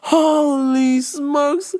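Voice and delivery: high, very slow exclamation; long sighed-out wonder after laughing; drawn-out amazement. Real, not acted. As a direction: stretch each word out in disbelief. Recovering from laughing fit.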